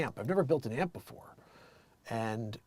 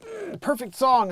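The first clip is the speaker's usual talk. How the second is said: mock lament; 9 semitones higher, 4 dB louder, slower than usual. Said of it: "afterwards I listen to it and I'm like".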